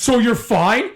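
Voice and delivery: high, loud disbelieving question; incredulous echo. Retold or imitated. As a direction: throw it back as an outraged question. Echoes officer's words in disbelief.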